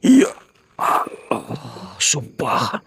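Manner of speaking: sudden loud gasping cry; startled shout at what he sees; frightened cry. Real, not acted. Ghost hunter seeing the face, frightened cry.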